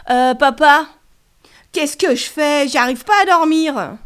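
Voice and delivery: loud, high call to dad across the house. Acted. Child calling dad at night.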